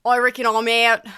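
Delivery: loud, put-on Australian accent; mock Australian accent slipping through, called out loudly; Chris Hemsworth. Thief telling his boss he's out, loud Australian accent. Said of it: "having a really hard time covering up his Australian accent".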